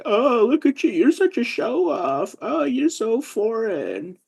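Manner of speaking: high-pitched, drawn-out mock teasing; mocking onlookers. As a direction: mimic scoffing friends in a slow, high voice. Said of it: "people look at me like".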